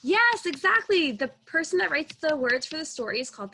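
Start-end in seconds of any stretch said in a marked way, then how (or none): 0.0-1.1 drawn-out, higher, louder praise; enthusiastic praise. Real, not acted. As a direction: cheer the right answer slowly. Teacher praising child's answer.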